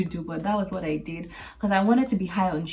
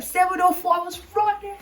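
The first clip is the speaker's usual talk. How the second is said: much higher, rushing, close to laughing; breathless excitement; 13 semitones higher than usual. Retold or imitated. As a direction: relive the dash for the train, racing. Late, sprinting for the train.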